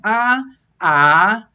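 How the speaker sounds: loud demonstration "ah, ah", level then rising; flat then rising tone. Shown as a demonstration. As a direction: hold one "ah" steady, glide the next upward. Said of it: "Okay, so again".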